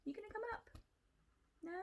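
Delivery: high-pitched invitation to a cat; pet-directed coaxing. Real, not acted.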